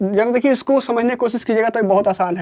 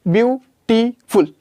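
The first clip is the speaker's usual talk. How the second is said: Beautiful said slowly in three beats; slowed into syllables; much slower than usual. Shown as a demonstration. Teacher to class, beautiful broken into three slow beats. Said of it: "इस तरीके से तीन बार में बोला जा रहा है".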